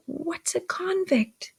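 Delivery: slow, high, hushed whisper; mouthed words. A narrator's reading. Pip secretly mouths a question to Joe, whispering. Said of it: "put my mouth into forms of saying".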